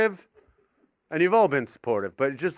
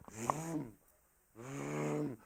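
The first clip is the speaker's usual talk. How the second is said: imitated engine vroom; 2 semitones lower, 16 dB quieter than usual. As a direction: hum out a quiet 'vroom'.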